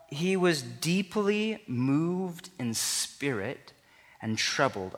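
deeply moved said very slowly; slow emphasis. Shown as a demonstration. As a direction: linger on every word. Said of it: "Underline that, star that, remember that".

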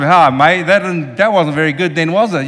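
loud, blunt Australian mate voice; a hint of an Australian accent, blunt; Aussie bluntness. Mimicking Australians after a bad sermon, loud and blunt Australian. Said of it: "Aussie, they walk around".

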